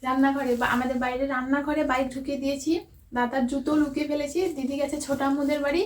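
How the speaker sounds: hushed voice. Real, not acted. Secret birthday prep, hushed so sister can't hear. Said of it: "আস্তে আস্তে কথা বলতে হবে".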